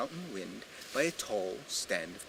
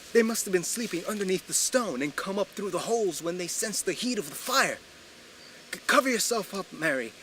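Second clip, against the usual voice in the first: louder, higher, urgent and panicked; frantic warning; 6 semitones higher, 8 dB louder than usual. Acted. Bitten husband frantically warns his bride to hide under the eiderdown. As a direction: warn her fast while the fangs strike.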